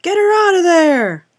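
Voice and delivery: loud, high-pitched furious yell; crowd's shout. A narrator's reading. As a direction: yell it out like one of a jeering mob. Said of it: "began to applaud and shout".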